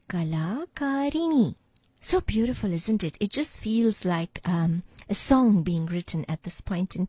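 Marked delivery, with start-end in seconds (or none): 0.1-1.5 "Kalakarini" slow and higher, falling at the end; model pronunciation. Shown as a demonstration. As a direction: present "Kalakarini" slowly, closing the pair. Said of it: "this is how you pronounce these two words".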